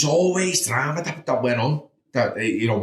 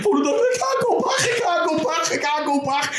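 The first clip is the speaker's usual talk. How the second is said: quoting his despair as it catches in his throat; choked-up, near tears. Real, not acted. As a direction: voice pitched high and breaking with a half-laugh, fading out. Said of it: "started crying my eyes out".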